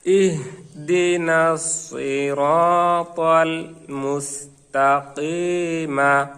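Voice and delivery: very slow, drawn-out Quran recitation; slow joined recitation. Shown as a demonstration. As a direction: join the whole verse, stretching the long vowels. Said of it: "এরপরে আমরা মিলিয়ে নেব". (আস্তে আস্তে টেনে তেলাওয়াত)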